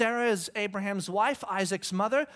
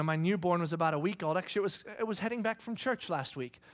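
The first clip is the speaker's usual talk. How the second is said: ordinary delivery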